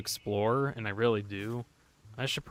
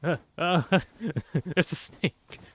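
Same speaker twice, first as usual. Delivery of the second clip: startled by the snake, laughing nervously; nervous laughing alarm; 5 semitones higher, 6 dB louder than usual. Real, not acted.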